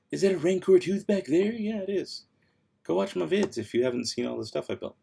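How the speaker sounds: usual speaking voice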